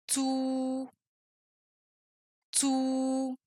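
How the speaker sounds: "tsu" long, level and soft; drawn-out tsu, twice with space between; syllable drill. Shown as a demonstration. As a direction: hold the u of tsu softly on one pitch. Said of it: "on va ajouter des voyelles".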